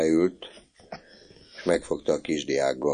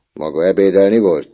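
louder, stern voice; prefect's question; 4 semitones higher, 9 dB louder than usual. A narrator's reading. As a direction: question him like a suspicious prefect.

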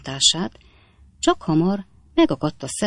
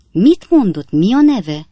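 loud, raised-pitch questioning; incredulous demand; 5 semitones higher, 8 dB louder, slower than usual. Acted. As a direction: ask loudly, scarcely believing it. Captain astonished by the answer.

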